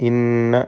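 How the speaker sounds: ഇന്ന with a held, humming double n; nasal doubled noon. Shown as a demonstration. Teacher shows students the doubled noon, ഇന്ന hummed. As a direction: hum through the nn of ഇന്ന. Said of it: "നൂൻ മുഷദ്ദദ മണിച്ചുകൊണ്ട് ഉച്ചരിക്കുക".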